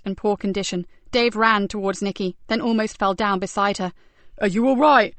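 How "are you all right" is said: breathless gasp; gasping after running; out of breath. Acted. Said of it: "he gasped".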